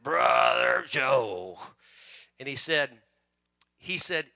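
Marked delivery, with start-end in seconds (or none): 0.0-1.6 put-on "Brother Joe." drawn out very slow in a loud, lower Southern accent; strong Southern accent. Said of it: "with that strong Southern accent".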